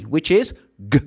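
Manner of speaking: ordinary talk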